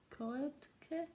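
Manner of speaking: "Koepke" said tentatively, pitch climbing; unsure pronunciation. Real, not acted. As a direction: try out "Koepke" in two syllables, lifting the end as if guessing. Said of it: "do not know how to say your name".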